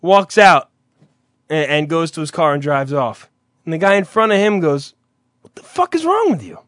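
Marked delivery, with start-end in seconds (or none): 5.4-6.6 imitated indignant stranger. Guy in line confronting another, imitated high indignation. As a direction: put on a high, incredulous complainer.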